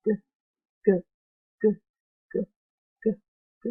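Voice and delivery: g said with a heavy extra push; overstressed g, as pupils wrongly do; wrong stressed g. Shown as a demonstration. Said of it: "we don't put an extra sort of emphasis on that first sound".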